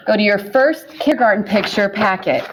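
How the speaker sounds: ordinary talk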